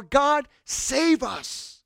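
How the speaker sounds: high, drawn-out plea; crying out. Acted. Palm-waving crowd to God, crying out slowly. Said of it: "cried out".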